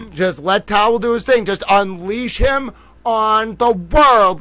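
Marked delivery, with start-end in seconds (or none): 1.5-4.4 slow, louder grand announce; hammed-up boast. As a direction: play the showman.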